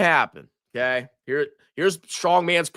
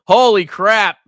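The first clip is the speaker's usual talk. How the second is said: ordinary delivery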